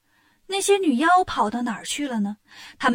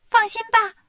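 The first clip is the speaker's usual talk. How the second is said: high, eager little bug voice; little insect voice; 9 semitones higher, 4 dB louder than usual. Acted. Insects reassuring the demon godmothers in a high little voice. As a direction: chirp it up like a tiny creature.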